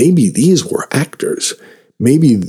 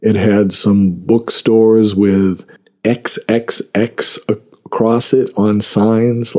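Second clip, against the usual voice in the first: normal talking voice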